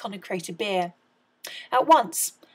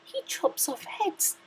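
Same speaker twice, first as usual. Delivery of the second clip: slow, whispered horrified thought; Cindy whispers her shocked thought; thinking aloud; 9 semitones higher, 6 dB quieter, slower than usual. A narrator's reading. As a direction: breathe it to herself in horror. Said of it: "she thought".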